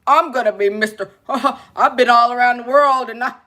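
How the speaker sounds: lightly voiced show-off; mock a man bragging about his worldliness; a hint of puffed-up self-importance; boastful man. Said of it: "They think".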